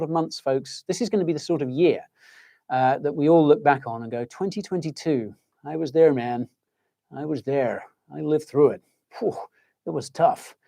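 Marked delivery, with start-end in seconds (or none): 4.3-10.5 put-on light American accent, nostalgic veteran; a touch of American accent, like an old-timer recalling hard times. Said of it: "I speak in an American accent".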